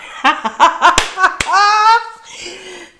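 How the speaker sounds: a bursting high laugh, caught off guard; loud high laugh. Real, not acted. Streamer teased about crying, bursting high laugh. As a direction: laugh out loud, flustered by the teasing.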